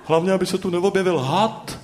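high, quick, worried bird. A narrator's reading. Bird Noha frets about a snake in town, worried. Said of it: "strachoval se pták Noha".

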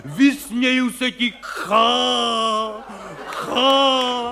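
slow, theatrical defiance, voice up; hammed-up heroic roar. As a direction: bellow at the wind like a stage hero. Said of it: "prostě řvou". (hraný hrdinský řev, pomalu a výš)

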